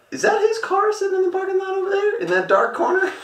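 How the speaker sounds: high, put-on sneaky voice; mock conspiratorial. Buddies passing the high school, mock conspiratorial question. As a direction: play schemers spotting their target in a squeaky voice. Boys spotting the hated guy's car.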